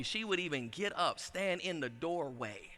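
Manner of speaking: usual speaking voice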